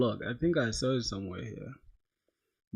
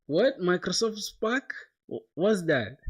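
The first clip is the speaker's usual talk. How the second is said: surprised; 6 semitones higher, 3 dB louder, slower than usual. Real, not acted. Viewer mentions unknown product.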